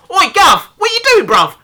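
put-on London accent. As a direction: yell it in a broad London accent. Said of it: "What the hell accent was that?".

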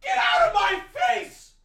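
very high screaming yell; furious high shriek; angry shout. Real, not acted. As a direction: scream it at the screen. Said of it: "I'm so sorry for getting that angry".

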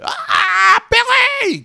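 very high, loud startled shout; startled shriek. Retold or imitated. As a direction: shriek the name in surprise. Startled mom in shower.

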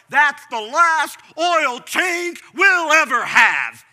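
hammed-up outburst, building to a yell; mock overreaction. Pastor mocking the foolish reaction to a bad oil change, slow to a laughing shout.

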